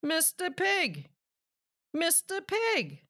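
calling to a neighbour who won't answer. A narrator's reading. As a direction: call through the door to the pig. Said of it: "I called".

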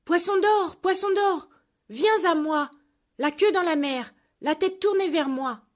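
high, loud call out to sea. Acted. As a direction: summon the fish across the water. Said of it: "et cria".

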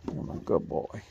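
whispered praise. Real, not acted. Praising the dog.

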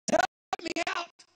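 high, slow pleading cry; plea for help. Retold or imitated. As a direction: beg to be pulled out. Preacher voices himself pleading.

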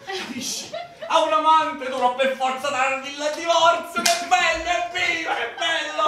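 laughing with triumphant glee; gleeful laughing. Acted. Husband gloating that being cheated frees him, laughing gleefully. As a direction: crow with delight through laughter. Husband delighted his plan worked.

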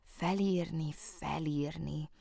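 soft, slow "felírni" relished; savoring the word. A narrator's reading. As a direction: taste the word "felírni" softly. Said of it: "Ez sokkal szebben hangzik".

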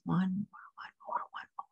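trailing whisper, fading away. Acted. Guide easing the listener off the last stair, whispering. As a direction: let each repeat fade further.

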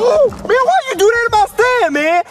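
high, aggrieved outburst; upset protest. Acted. Seller to officer who shut his stand, upset protest. Stand has just been wrecked.

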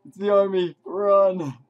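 loud urgent calls to run; urgent shouting. Real, not acted. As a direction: shout at them to flee. Fleeing the enemy army.

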